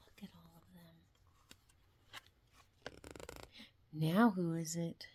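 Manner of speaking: hushed suspense whisper. Acted. Asking the child who it is, whispering.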